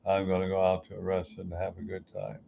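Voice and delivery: flat, soft, lifeless read; no passion. Shown as a demonstration. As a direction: say it like you don't care. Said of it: "How much passion do you think I put into it".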